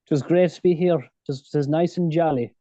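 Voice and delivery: Irish Kerry accent. Shown as a demonstration. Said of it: "that's a Kerry accent, what I just did".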